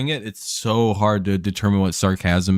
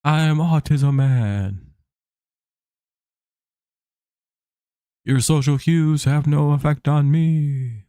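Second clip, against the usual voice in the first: slow, higher mock hero announcement; mock superhero voice; 6 semitones higher, slower than usual. Streamer jokes to chat in a higher, slow superhero voice. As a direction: proclaim it like a hammed-up comic-book hero. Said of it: "my jokes usually die".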